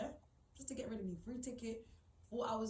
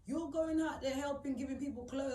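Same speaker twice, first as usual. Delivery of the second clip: loud, higher-pitched reproach; heated, raised-voice accusation; indignant outburst; 6 semitones higher, 7 dB louder than usual. Real, not acted. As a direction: let the grievance burst out louder. Confronting family's charity hypocrisy.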